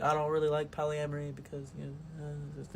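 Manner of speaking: barely audible, shy and hesitant; soft-spoken girl. Retold or imitated. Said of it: "She was very soft-spoken".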